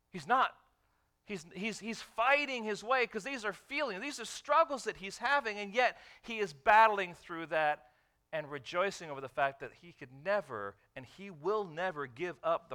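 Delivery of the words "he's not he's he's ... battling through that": higher, louder preaching push; rising fervor. Acted. Preacher to congregation, rising fervent insistence. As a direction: drive it forward with conviction.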